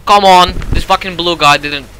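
loud exasperated shout; frustrated yell. Real, not acted. Gamer yelling at useless teammate, loud frustration. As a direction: yell it at the screen.

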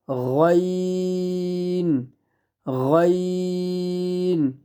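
গইন with rounded start and very long "ee"; long heavy গইন. Shown as a demonstration. Teacher drilling the letter, গইন heavy and drawn out. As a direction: stretch গইন four counts on one note. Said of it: "চার আলিফ পরিমাণ লম্বা হবে".

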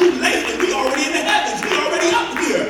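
loud, high, driving preacher's cry; shouting the sermon's climax; fiery preaching. Acted. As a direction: lift the church with each line. Preaching at full force.

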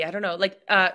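usual speaking voice

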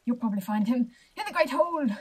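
loud, hammed-up guard announcement; over-dramatic character proclamation. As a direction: declare it with grand theatrical weight. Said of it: "Very dramatic, [name]".

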